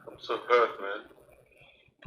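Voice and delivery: choked up, fading to a breathless near-whisper on man; hurt words that break into tears; real crying. Real, not acted. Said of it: "I hear you crying".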